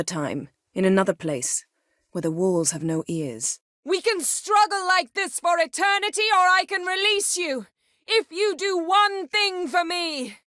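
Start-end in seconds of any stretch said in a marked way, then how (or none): 3.8-10.4 high, echoing spectral voice; otherworldly voice. Acted. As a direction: bargain like a trapped spirit. Otherworldly figure bargains while restraining.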